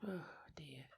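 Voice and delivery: whispered oh dear under the breath; a soft whispered sigh of dismay. Real, not acted.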